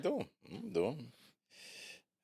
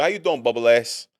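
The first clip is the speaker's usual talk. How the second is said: lightly mimicked coworker, louder; imitated catcall greeting; 8 dB louder than usual. Man retelling how he greeted an Italian coworker, mock catcall. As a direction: toss out a loud teasing hello. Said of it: "like, an Italian woman".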